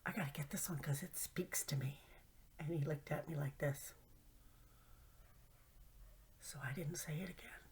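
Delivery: unvoiced sheepish whisper. Real, not acted. Confessing crystal habit to viewers, whispered. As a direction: whisper it like a guilty secret. Secretive aside about husband.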